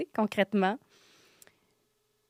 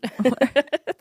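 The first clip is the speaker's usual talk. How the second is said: éclat de rire fort et haut; rire aigu; 5 semitones higher, 10 dB louder than usual. Real, not acted. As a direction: rire franc et sonore. Rire après «complètement folle». (éclat de rire aigu)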